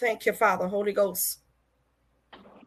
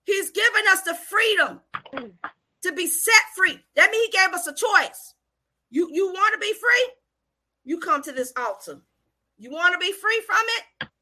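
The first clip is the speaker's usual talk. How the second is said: high, urgent altar-call preaching; 8 semitones higher, 3 dB louder than usual. Real, not acted. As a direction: call them forward with rising questions.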